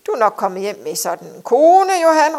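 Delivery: booming, blustering hail, rising on the name; loud shout. A narrator's reading. Captain hailing Johan about his new wife, shouting boisterously. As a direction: call out loudly from a distance. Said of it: "råbte han højlydt".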